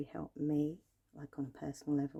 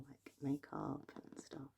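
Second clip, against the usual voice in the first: slow whisper; 5 dB quieter, slower than usual. Acted. ASMR whispering.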